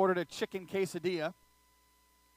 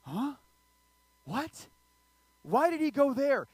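higher, baffled questions; shocked listener; 5 semitones higher, slower than usual. Pretend. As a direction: react like you can't believe it. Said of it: "you'd be like".

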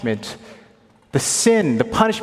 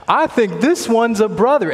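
much higher and louder second go; excited repeat; 10 semitones higher, 7 dB louder than usual. Retold or imitated. Retelling the screening lady's words, now higher and louder. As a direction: say it again, lit up and loud.

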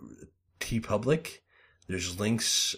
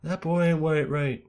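lightly voiced Texan dad line, a bit higher; terrible Hank Hill; 7 semitones higher than usual. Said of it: "terrible Hank Hill impression".

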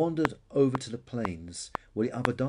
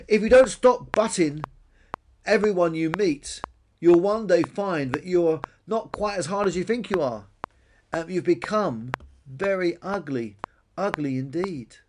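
shouted warning; 6 semitones higher, 6 dB louder than usual. A narrator's reading. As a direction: call a warning after him, slowing at the end. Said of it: "shouted Billy".

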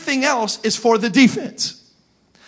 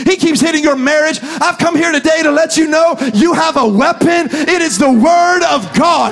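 loud, rising pulpit preaching; full-force preaching; 5 semitones higher, 3 dB louder than usual. Real, not acted. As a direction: preach it hard and build to a roar.

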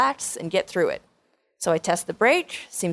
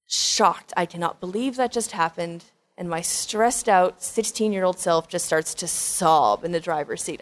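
ordinary talk, nothing marked

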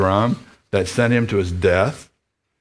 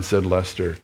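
usual speaking voice

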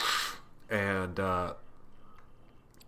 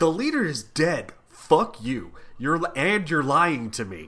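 higher, louder indignant retort; mock angry rebuke; 4 semitones higher, 3 dB louder than usual. Podcaster voicing the Hulk accusing Samson of lying, mock anger. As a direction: throw the accusation back, hammed-up anger.

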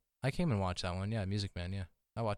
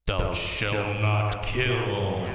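very slow, louder solemn proclamation; booming commandment voice; 5 dB louder, much slower than usual. Pretend. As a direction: drag every word out, booming. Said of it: "That's awesome".

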